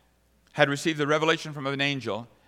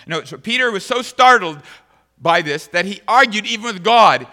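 higher, louder preaching; preaching at full force; 5 semitones higher, 11 dB louder than usual. Acted. Preacher telling the church Peter argued with God, loud. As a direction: drive the story home.

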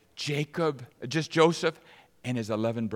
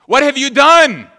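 much higher and much louder, slow and drawn out; furious shout; 12 semitones higher, 16 dB louder than usual. Acted. Joseph shouting at his brothers who stole the cup, slow furious shout. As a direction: bellow the accusation slowly.

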